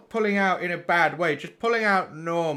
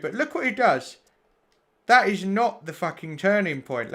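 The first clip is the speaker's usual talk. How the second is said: ordinary delivery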